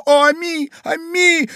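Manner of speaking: very high wailing call; lady crying out. A narrator's reading. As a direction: wail for the lost pet. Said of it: "кричала дама". (вопль дамы)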